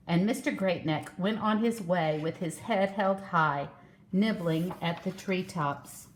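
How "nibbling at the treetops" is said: usual speaking voice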